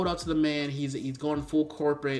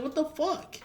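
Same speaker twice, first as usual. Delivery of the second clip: high, incredulous what the fuck; exasperated disbelief; 12 semitones higher, slower than usual. Real, not acted. A fan fed up with the game, high exasperated disbelief. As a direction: throw up your hands in disbelief.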